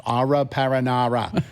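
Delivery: "Aura paranara" met een platte New Yorkse klinker, paranoia wordt paranara; mock New York accent. Shown as a demonstration. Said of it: "That was my New York accent".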